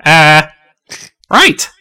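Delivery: high, jumpy exclamations; flustered Eddie. Acted. Eddie taking a turn in battle, flustered high outburst. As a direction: blurt it out, pitch leaping, then rally. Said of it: "Eddie goes".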